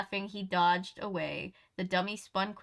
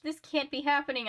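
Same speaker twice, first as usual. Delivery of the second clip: frightened cry; 6 semitones higher than usual. A narrator's reading. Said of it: "I cried".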